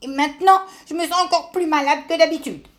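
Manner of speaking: cross sick tortoise complaining; angry complaint. Acted. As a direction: whine crossly about feeling worse. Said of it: "en colère".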